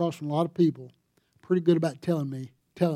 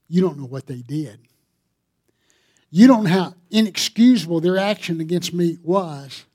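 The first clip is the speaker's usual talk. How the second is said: indignant objection; 8 dB louder than usual. Acted. Voicing congregation's objection.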